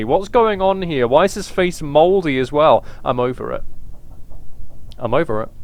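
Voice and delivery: ordinary talk, nothing marked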